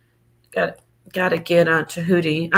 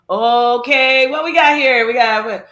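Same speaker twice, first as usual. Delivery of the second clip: rapid monotone auctioneer chant. Acted. Streamer auctioning Neo X, auctioneer chant. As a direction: rattle bids off on one pitch. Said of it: "that's the auction".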